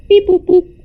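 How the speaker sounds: robot beeps. Pretend. Imitating scanner sounds.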